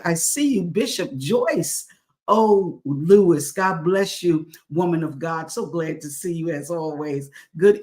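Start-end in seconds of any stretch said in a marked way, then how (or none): 0.6-3.5 drawn-out name call. Real, not acted. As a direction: stretch the name out slowly and loudly. Greeting a viewer by name.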